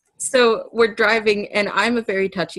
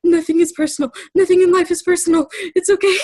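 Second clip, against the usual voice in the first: higher, louder, rushed, choked-up mantra; crying mantra; 11 semitones higher, 5 dB louder, faster than usual. Retold or imitated. To herself while crying at the wheel, choked-up mantra. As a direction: repeat it to calm yourself while falling apart. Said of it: "essentially as a mantra, as I'm crying and shaking".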